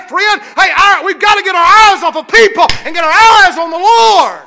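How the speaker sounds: high, loud, shouted preaching; impassioned shouting preacher; preaching at full force. Acted. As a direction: cry it out to the congregation.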